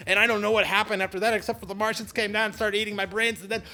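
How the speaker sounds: breathless hype as the tale goes off the rails; excited storytelling. Acted. Vlogger telling a crazy flying-car tale, excited and fast. Climax of an invented tall tale.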